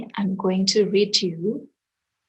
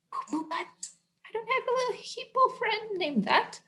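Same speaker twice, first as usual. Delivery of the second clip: high hushed protest; embarrassed; 12 semitones higher, 8 dB quieter than usual. Pretend. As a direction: squeak out an embarrassed denial.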